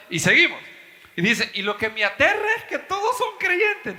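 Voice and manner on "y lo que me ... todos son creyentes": quoted with a laugh. Retold or imitated. Quoting atheist, laughing.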